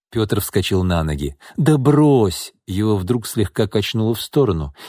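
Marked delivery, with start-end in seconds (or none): 1.6-2.5 higher, insistent protest; urging protest. A narrator's reading. Pyotr jumps up as Alexei leaves, urging protest. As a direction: wave the objection away, raised pitch. Drunk man stops his guest leaving.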